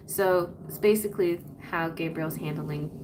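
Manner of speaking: ordinary talk, nothing marked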